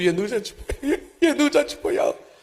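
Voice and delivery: high, hammed-up wail with a laugh in it; mock wailing. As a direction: imitate the endless lament. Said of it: "పడి పడి ఏడుస్తూ". (ఎత్తైన స్వరంలో నవ్వుతో కూడిన నకిలీ ఏడుపు)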